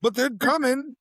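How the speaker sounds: high, hyped-up insistence; mock conspiratorial. Telling the kid the overlords come, hammed-up and high. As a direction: sell it like a true believer.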